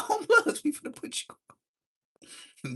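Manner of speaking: high squeal breaking into laughter; laughing. Retold or imitated. Teller retelling Smiley's promise while laughing high. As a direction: say it again, cracking up halfway. Retold line again, laughing.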